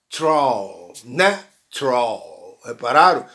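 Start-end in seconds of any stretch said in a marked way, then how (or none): 1.0-2.6 slow, lower "natural" split as na, tral; "natural" with no middle vowel, na; two-syllable natural. Shown as a demonstration. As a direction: break "natural" into two drawn-out beats. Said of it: "para apenas duas sílabas".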